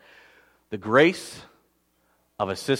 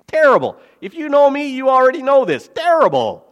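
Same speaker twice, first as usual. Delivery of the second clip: loud, much higher, laughing at himself; emphatic self-mockery; 11 semitones higher, 8 dB louder than usual. Real, not acted. Pastor tells the congregation he's hopeless with tools, loud and high. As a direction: overstate it, poking fun at your own clumsiness. Pastor mocks own handiness.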